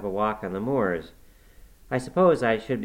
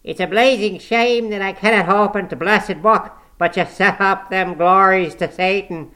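put-on harsh scornful old servant; 10 semitones higher, 11 dB louder than usual. A narrator's reading. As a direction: scold her with harsh scorn. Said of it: "harsh words of scorn and intolerance".

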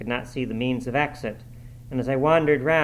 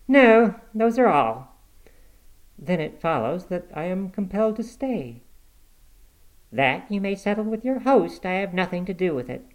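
put-on dismissive young woman; curt young woman; 7 semitones higher than usual. Catherine voiced, curt.

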